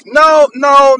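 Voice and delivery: forceful repeated no; emphatic loud no. Real, not acted. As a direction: shut it down hard.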